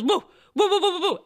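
playful mock barking, slightly laughing; imitated dog barking. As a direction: woof like an alerted dog. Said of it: "kinda goes".

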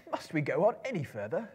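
a tired hobbit pleading; Bilbo's plaintive voice. A narrator's reading. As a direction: hobbit asks the wizard to stop. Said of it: "asked Bilbo".

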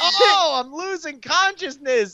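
high, theatrical wailing; mock cries of a fading victim; hammed-up anguish. Acting out passing out under attack.

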